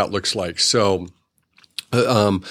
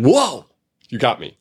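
playful surprise; 2 semitones higher than usual. Shown as a demonstration. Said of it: "I jerk back and attune to them".

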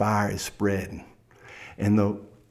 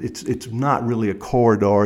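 ordinary delivery

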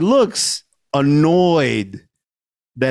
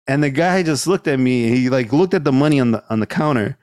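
ordinary delivery

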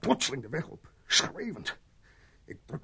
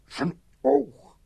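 slow, louder, higher horrified recall; 6 semitones higher, 8 dB louder, slower than usual. Acted. Mitchell describes the dead man's face to the sergeant, aghast. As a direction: force each word out as if seeing it again.

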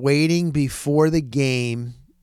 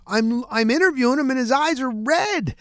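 higher-pitched, defensive retort; raised-voice protest; 10 semitones higher than usual. Retold or imitated. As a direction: protest high, as if defending the question. Defends his question to critics.

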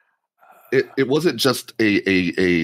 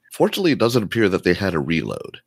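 ordinary talk, nothing marked

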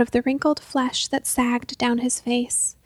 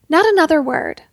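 louder, sharp command to silence; stern shout; 4 semitones higher, 8 dB louder than usual. Acted. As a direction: cut him off hard. Mother cuts son off sharply.

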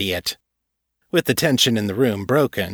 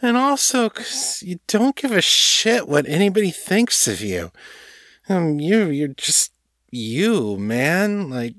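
high, tipsy gushing; drunken rambling; 10 semitones higher than usual. A narrator's reading. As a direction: ramble admiringly in a drunk girl's voice. Drunk woman rambling admiringly.

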